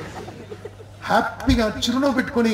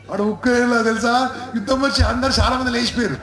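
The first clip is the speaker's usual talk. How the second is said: high-pitched, wrongly gleeful telling; mock cheerful news-breaking; 6 semitones higher than usual. Bearer of war news, inappropriately cheerful and high. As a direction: deliver terrible news as if it were fun. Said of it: "ఇది నవ్వుతూ చెప్పాలా".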